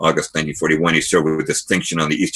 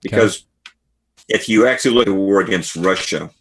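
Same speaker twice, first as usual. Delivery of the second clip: ordinary talk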